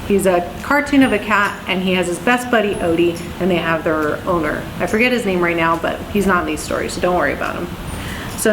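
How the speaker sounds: ordinary delivery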